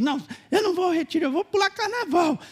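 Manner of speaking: quick, high mock refusal; defiant girlfriend. Preacher mimics girlfriend refusing the retreat, high quick defiance. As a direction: toss it off like a carefree girlfriend.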